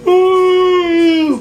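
sudden high, loud ooh; high ooh shout. Real, not acted. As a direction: let out a startled high ooh. Sudden exclamation mid-chat.

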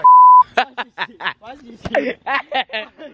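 long, high-pitched burst of laughing; loud high laughter. Real, not acted. Laughing at friends clowning by the fire, hysterical. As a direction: crack up loudly.

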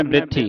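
ਬਿਰਥੀ as brit-thee; quick joined cluster. Shown as a demonstration. Teacher models correct reading, ਬਿਰਥੀ quick as brit-thee. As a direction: run b and r together in ਬਿਰਥੀ. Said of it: "ਜਲਦੀ ਦੇ ਨਾਲ ਉਚਾਰਨ".

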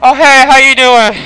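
high, loud, nervous hello; alarmed greeting. Real, not acted. Nervously greets sudden game figure.

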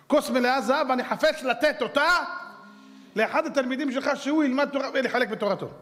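high-pitched, insistent declaration; acted eager patron, pitched well up; eager rich man. Rich man repeats his offer.

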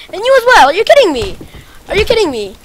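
high, loud are you kidding me; exasperated yell. Real, not acted. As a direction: shout at yet another enemy. More enemies show up.

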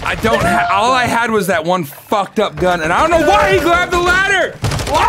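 loud, high, fast exasperated shout; exasperated yell. Real, not acted. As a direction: yell at teammate in disbelief. Exasperated at teammate.

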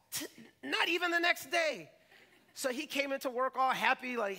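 ordinary delivery

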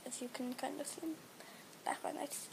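whispered aside. Real, not acted. Telling viewers about her bedroom light in a whisper. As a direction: drop to a whisper.

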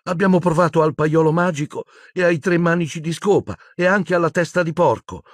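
high, tearful list; sobbing girl. A narrator's reading. Hermione inventing her search, high sobbing voice. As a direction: whimper through it in a high voice. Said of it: "singhiozzò Hermione".

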